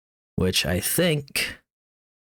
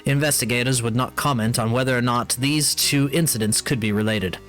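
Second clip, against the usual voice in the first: news read; 4 semitones higher than usual. Acted. Reads in-game newspaper article.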